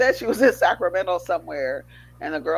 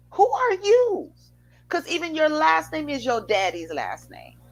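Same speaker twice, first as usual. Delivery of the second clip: real rising anger; 8 semitones higher, 3 dB louder than usual. Real, not acted. Ranting about women taking a husband's name, real anger. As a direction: demand it, voice climbing in outrage. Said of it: "I'm getting mad".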